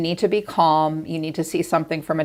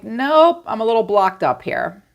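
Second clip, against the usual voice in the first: mock frustrated; 5 semitones higher than usual. Imitating the reader's blocked-up listener.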